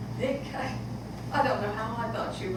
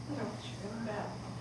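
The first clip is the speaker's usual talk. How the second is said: soft quick repeat; 13 dB quieter, much faster than usual. Acted. Daughter repeats it softer.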